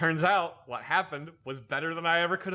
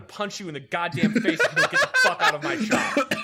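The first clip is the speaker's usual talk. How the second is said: retold furious tirade, rising louder and higher; angry outburst; 5 semitones higher, 5 dB louder than usual. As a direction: blow up at the boss, building to a yell. Retold blow-up at the chief.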